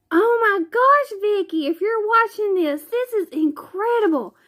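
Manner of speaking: high-pitched thrilled gushing; amazed excitement. Real, not acted. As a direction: gush to the gift giver in a high voice. Amazed by the handmade gift.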